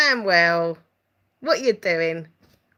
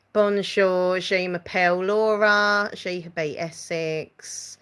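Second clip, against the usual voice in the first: put-on slow schoolgirl French in a broad Essex accent; slower than usual. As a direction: read French aloud with plodding Essex vowels. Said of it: "I'd have my Essex accent and I'd be like".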